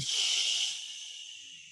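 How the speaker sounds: long, whispered shushing; soft shh. Shown as a demonstration. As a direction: breathe out a quiet shh. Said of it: "we're gonna make a shh sound".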